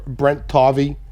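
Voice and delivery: ordinary talk, nothing marked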